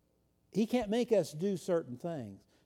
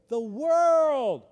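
louder and higher on "The world!"; emphatic shout; 5 semitones higher, 6 dB louder than usual. Real, not acted. Preacher exclaiming to congregation, loud. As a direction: proclaim "The world!" to the back row.

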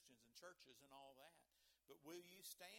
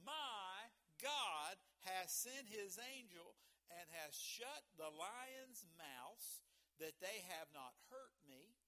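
very slow, louder, every word weighed; slow dramatic reading; 6 dB louder, much slower than usual. Shown as a demonstration. Preacher rereading Daniel's reply to the congregation, slow and emphatic. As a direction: deliver it slowly like the climax of the sermon. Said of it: "One more time".

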